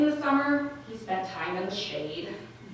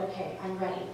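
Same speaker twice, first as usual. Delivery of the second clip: calm lover; 7 dB quieter than usual. Acted. Acted lover, calmly waiting to look.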